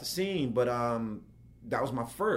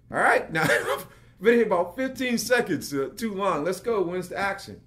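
impatient; 6 semitones higher, 4 dB louder than usual. Retold or imitated. Impatient in trunk awaiting action.

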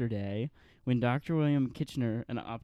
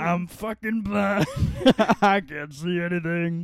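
put-on Louis voice; 4 semitones higher, 6 dB louder, slower than usual. Playing blind [name].

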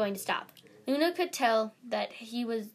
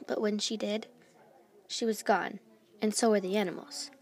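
ordinary delivery, nothing marked

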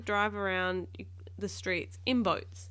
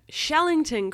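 loud, higher-pitched call; cried out; 9 semitones higher, 10 dB louder than usual. A narrator's reading. Captain calling Shellington for help, urgent cry. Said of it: "cried Captain".

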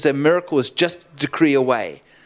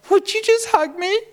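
very high, imitated tearful plea; mock-pleading. Weeping man at the restaurant door asking preacher, mock-pleading. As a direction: beg in a squeaky imitation.

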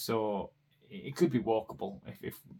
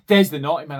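put-on louder, blurted "naughty man" like a kid; child announcing loudly; 5 semitones higher, 14 dB louder, faster than usual. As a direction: shout it out innocently. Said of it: "he's like".

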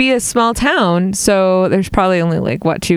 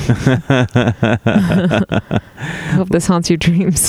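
lower, slow police partner voice; lower cop voice; 4 semitones lower, slower than usual. Pretend. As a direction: play the deadpan cop partner. Partner cop talking to driver.

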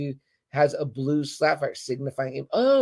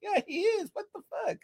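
much higher and much softer, squeaky; squeaky disbelief; 8 dB quieter than usual. Retold or imitated. Fan reacting to the show, high squeaky disbelief. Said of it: "I was like".

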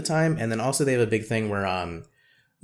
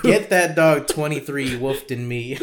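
slow, louder, every word hammed up; mock emphatic command; 3 semitones higher, 5 dB louder, slower than usual. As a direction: drag out a joking order to snitch. Joking advice, repeated.

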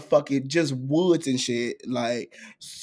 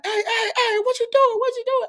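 calling out. Retold or imitated. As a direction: yell after a fleeing guy. The man calling after him.

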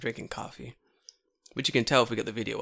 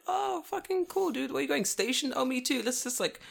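high-pitched chummy chatter; friendly greeting act; 12 semitones higher, 5 dB quieter than usual. Retold or imitated. Teacher bumping into his student, high chummy greeting. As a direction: put on a light, high greeting voice.

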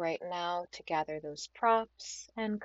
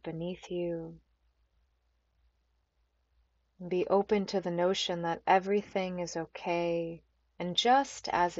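unhurried meditation guide with long pauses; calm, spacious yoga nidra voice; guided meditation. Acted. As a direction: ease them gently into stillness, phrase by phrase.

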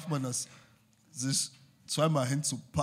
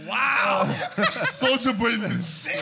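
loud, raised-pitch mock amazement; hyped onlooker shout; 8 semitones higher, 8 dB louder than usual. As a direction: act out an astonished shout, breaking into laughter.

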